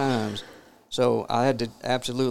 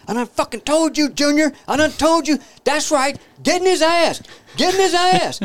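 very high-pitched, loud yelling; acted angry mama; 7 dB louder than usual. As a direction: imitate a mother hollering from the porch. Voices angry mama from the porch.

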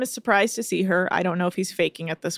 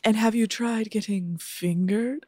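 softer, put-on genteel voice; wine-sipping socialite; 4 dB quieter, slower than usual. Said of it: "being like".

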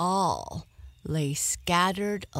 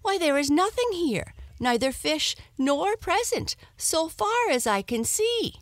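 much higher, louder, fast indignant wife; 11 semitones higher, 4 dB louder than usual. Acted. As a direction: scold him, nothing is here. Wife finds the cart empty.

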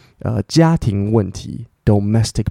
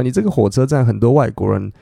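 usual speaking voice

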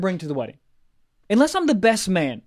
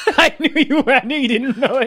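laughing; 6 semitones higher, 3 dB louder than usual. Real, not acted. Friend forgot song.

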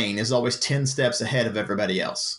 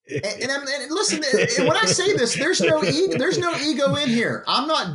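laughing; 13 semitones higher than usual. Real, not acted. Laughing, pitch way up.

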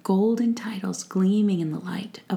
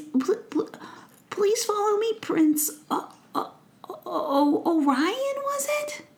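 high, nervous, stuttering little goblin; stammering goblin; 12 semitones higher than usual. Acted. Goblin nervously leading the prince, stammering high. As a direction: trip over every word like a flustered goblin.

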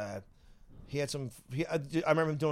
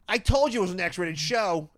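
imitated manager; 5 semitones higher, 6 dB louder than usual. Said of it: "the manager goes".

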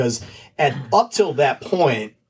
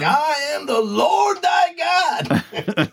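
very slow, high, shouted; imitating Jules' Bible verse, shouting; mock preaching shout; 14 semitones higher, much slower than usual. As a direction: boom it out like a wrathful preacher. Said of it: "You know, like".

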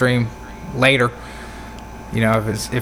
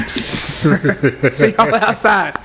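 laughing; 2 semitones higher, much slower than usual. Real, not acted. Laughing exit joke.

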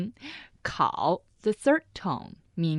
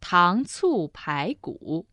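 slow, each syllable of "Tang cu pai gu" clearly apart; slow model; slower than usual. Shown as a demonstration. Teacher modelling for her co-host, slow and careful. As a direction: say "Tang cu pai gu" slowly so the learner hears every tone. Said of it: "Pai gu means spare rib".

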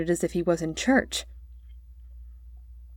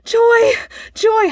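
high, loud shouts of joy; boy singing out his joy; joyful cry; 7 dB louder than usual. A narrator's reading. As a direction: leap about crying out for joy. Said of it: "leapt about the room singing".

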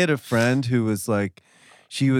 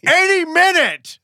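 rising, louder mock menace; mock threat; 9 semitones higher, 3 dB louder than usual. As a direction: ham up an alien threat.

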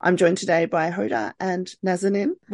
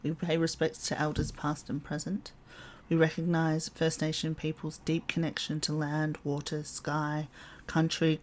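measured, even acknowledgement of country; solemn scripted statement; formal acknowledgement reading; 3 semitones lower, 6 dB quieter than usual. Acted. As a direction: read it soberly, lower-key than the chat.

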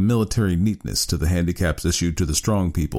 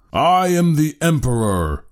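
high, slow proclamation, louder; Harrison cries out; 9 semitones higher, 4 dB louder, slower than usual. A narrator's reading. Harrison proclaiming himself to the studio, booming. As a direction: declare yourself emperor with force. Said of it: "cried Harrison".

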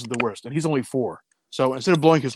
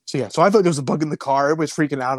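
ordinary delivery

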